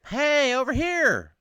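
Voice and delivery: long, high, loud distress call; crying out. Acted. Sinking boater calling to a distant boat, crying out. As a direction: holler slowly to a faraway boat. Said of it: "you cry out".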